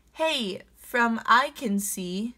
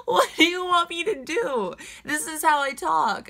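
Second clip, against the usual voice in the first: high, loud, indignant retort; defiant exclamation; 8 semitones higher, 5 dB louder than usual. Real, not acted. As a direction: throw the question back, loud. Defiant reply to commenter.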